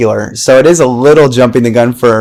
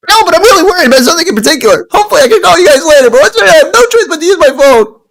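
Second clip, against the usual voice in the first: much higher, fast squeaky voice; high, fast retake; faster than usual. Acted. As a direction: rattle it off high and quick. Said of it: "wrong emotion for every single part".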